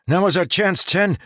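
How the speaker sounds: high, loud urgent plea; terrified scream. Acted. Jarek screaming at Ten to act, terrified. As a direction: scream over your own fear. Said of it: "Jarek screamed against the terror".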